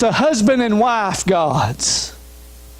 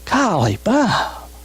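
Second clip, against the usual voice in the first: usual speaking voice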